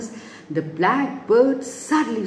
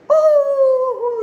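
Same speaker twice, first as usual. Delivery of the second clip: high, loud delighted gasp of "oh"; delighted character voice; 6 dB louder than usual. Acted. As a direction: exclaim with high-pitched delight. Voicing delight at the food.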